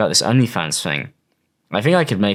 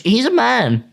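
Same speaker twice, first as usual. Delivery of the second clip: mocking; 11 semitones higher, slower than usual. Real, not acted. Mocking male behaviour.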